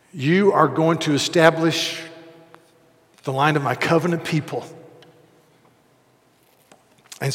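loud, driving sermon delivery; preaching at full force. Acted. Pastor proclaiming Abraham's story to the congregation, loud preaching.

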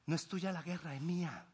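soft, low reassurance; tender divine assurance; gentle voice of God. Acted. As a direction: speak it as God calming you. God's words quoted gently.

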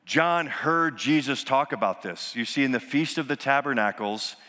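ordinary delivery

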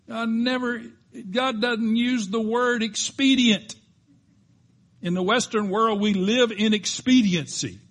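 high, loud preaching climb; full-force preaching. Acted. Preacher warning the congregation about impatience, loud and high.

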